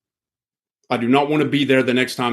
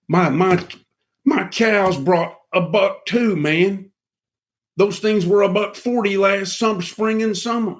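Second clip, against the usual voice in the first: higher, slow, aggrieved rancher griping; caller complaining; 7 semitones higher, slower than usual. Retold or imitated. Imitating a complaining caller on the phone, higher pitched. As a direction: whine like a caller who got a bad price. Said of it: "I've got several calls".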